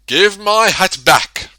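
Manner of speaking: loud, slow angry demand; angry shout. A narrator's reading. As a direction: bellow it at him slowly. Angry man demands his hat.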